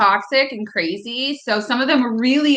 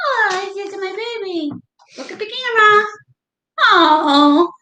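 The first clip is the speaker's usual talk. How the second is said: cooing at a pet; 9 semitones higher than usual. Real, not acted. Cooing over her dog.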